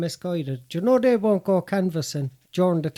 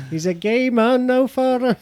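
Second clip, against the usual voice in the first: Catherine Tate sketch voice; 7 semitones higher, 5 dB louder, slower than usual. Retold or imitated. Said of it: "Do you remember Catherine Tate?".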